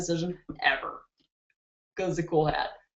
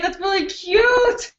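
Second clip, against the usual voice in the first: gushing; 11 semitones higher, slower than usual. Real, not acted. Gushing over viewer's hashtag.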